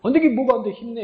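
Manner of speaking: high, brisk scolding push; mock scolding encouragement. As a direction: snap him out of it like a big brother. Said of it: "그러면 내가 혼내야 되잖아".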